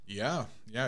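usual speaking voice

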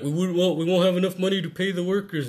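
imitating worried board members; whiny worker voice. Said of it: "they're like".

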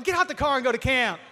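loud, high mock yell at his daughter; fed-up dad barking her out the car; half-joking shouted command; exasperated order.

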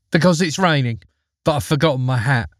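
put-on high, matter-of-fact little girl; girl's voice. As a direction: explain it like a child. Girl answers the bear.

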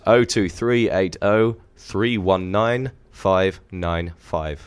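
very slow, each digit spaced; slow careful readout. Shown as a demonstration. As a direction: read the number out slowly. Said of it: "Was that again, [name]?".

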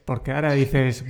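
ordinary talk

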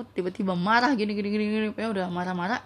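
ordinary talk, nothing marked

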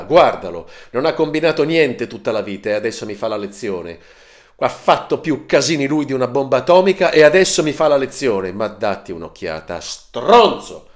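loud, high ranting screams; screaming like a madman. Acted. Marco storming off to his room: high loud raging rant. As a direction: rant at full volume like you've lost it. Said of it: "continuando a strillare come un pazzo".

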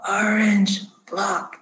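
slow, whispery rasp; hoarse, halting intercom message; raspy voice. Acted. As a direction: drag out each word like a man in a trance. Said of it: "he's just still saying those, those words".

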